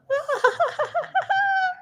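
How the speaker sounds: high-pitched rapid cackle; laugh. Real, not acted. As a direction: burst into a high giggling laugh. Laughs at own teasing.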